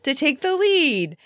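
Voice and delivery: raspy-free bright high teasing parent voice; playful quizzing mom. Retold or imitated. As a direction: sneak math questions in, playfully. Mom quizzing kids at game.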